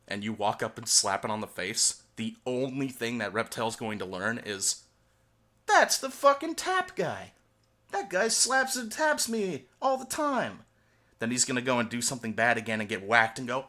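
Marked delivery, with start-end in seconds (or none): 5.7-10.6 high, drawn-out cartoon voice; slow, high-pitched comic grievance; resentful reptile. Reptile recognising the man who taps it, resentful cartoon voice.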